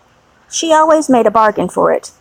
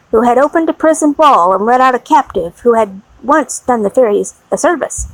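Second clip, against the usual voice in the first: plain reading voice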